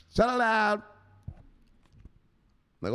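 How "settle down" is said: high-pitched sharp order; scolding enforcer. Acted. Enforcer's parting shot, high scolding.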